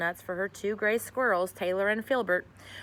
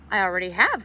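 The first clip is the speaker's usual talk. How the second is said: coldly; 9 dB louder than usual. A narrator's reading. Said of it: "said Missus Eager coldly".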